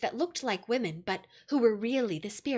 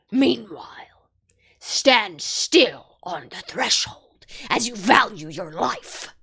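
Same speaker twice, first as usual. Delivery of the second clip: threatening wolf; 7 dB louder, slower than usual. A narrator's reading.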